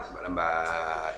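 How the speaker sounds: low, dragged-out, slow and soft; mock slow drawl. Preacher mocking a deliberately slow wudu, low and slow. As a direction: stretch the words out lazily, low and slow.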